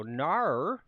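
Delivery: stretched Aussie no with sliding vowels; mock-Aussie drawn-out "Nar"; Australian "no". Shown as a demonstration. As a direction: drag the vowel through an Australian glide. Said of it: "the way they say no, then they add, like, fifty vowels".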